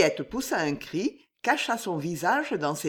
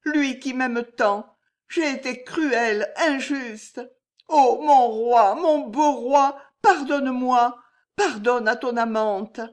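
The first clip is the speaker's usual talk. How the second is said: high, slow, tearful plea; tearful lament; 6 semitones higher, 6 dB louder than usual. Acted. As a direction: cry out to her absent king. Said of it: "en pleurant".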